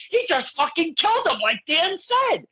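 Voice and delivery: high-pitched retold exclamation; imitating dad's exclamation. Retells dad's shocked reaction.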